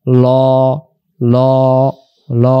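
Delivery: ল said with the mouth rounded, heavy and dark. Shown as a demonstration. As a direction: round the lips on each ল. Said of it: "মুখটা ... গোল হবে".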